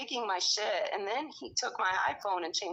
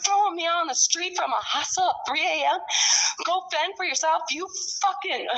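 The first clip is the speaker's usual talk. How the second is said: retold yelling; 7 semitones higher, 6 dB louder than usual. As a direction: reenact the blowup at the staff. Acting out yelling at hostel staff.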